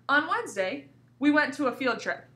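slight break after On Wednesday, then on; teacher shows the comma pause after Wednesday. Shown as a demonstration. Teacher to class, pausing where the comma goes. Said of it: "Did you hear where my voice broke?".

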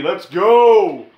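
loud, high hype shout; loud "Let's go!" punch; excited cheer. Real, not acted. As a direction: celebrate it like a win.

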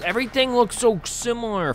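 ordinary delivery

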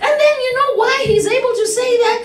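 high, emphatic preacher with stretched key words; fired-up preaching. Real, not acted. Preacher urging congregation to underline it, fired-up preaching. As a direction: drive the verse home with rising fervour.

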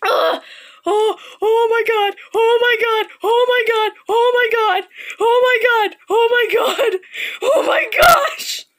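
high, loud repeated shrieks of fear; frightened shrieking. Real, not acted. Gamer chased by the monster, repeated terrified shrieks. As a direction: keep shrieking in terror. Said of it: "for that screeching hollering".

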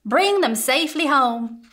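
cheerful sheriff calling out the order; Sheriff Parr. Acted. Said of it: "said Sheriff Parr".

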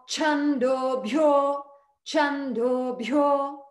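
bhyo very slow with a breathy bh and a long o; aspirate and long. Shown as a demonstration. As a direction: chando bhyo, stretch the bhyo with a strong aspirate. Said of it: "Aspirate and long".

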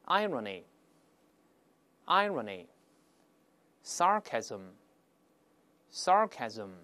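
very slow, widely spaced words; read-aloud demo. Shown as a demonstration. Teacher leading learners in rereading the nouns, slow demonstration. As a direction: model each word, then pause for repetition. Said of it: "我们大声来".

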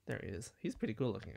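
ordinary talk, nothing marked

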